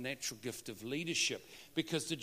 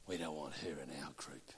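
whisper; 12 semitones lower, 12 dB quieter than usual. Retold or imitated. Congregation voiced excluding Sue.

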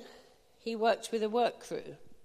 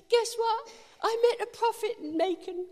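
much higher, bursting with excited news; 11 semitones higher than usual. Retold or imitated. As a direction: blurt it out like you can't wait to tell. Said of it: "I was so excited".

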